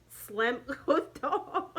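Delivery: words breaking into a laugh; laughing while telling. Real, not acted. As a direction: crack up mid-sentence at your own story. Laughs while retelling slammed door.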